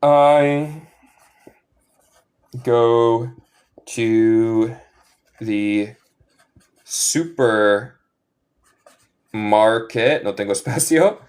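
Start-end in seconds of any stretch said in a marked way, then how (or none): 0.0-10.2 very slow, word by word, I go to the supermarket; slow dictation. Shown as a demonstration. Teacher dictating an English example to learners: very slow, word by word. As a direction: dictate it so they can write each word. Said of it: "dictating example sentence".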